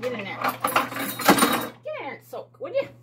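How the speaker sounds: playful high-pitched urging; mock coaxing. Talking to the bowl.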